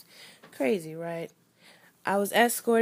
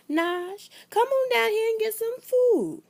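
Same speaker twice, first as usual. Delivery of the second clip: put-on much higher, louder Jacksonville accent call; Southern grandma accent; 7 dB louder than usual. A grandmother calling her grandchild to come eat, Southern accent. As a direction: holler down the stairs like a Southern grandma. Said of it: "with the biggest accent in the world".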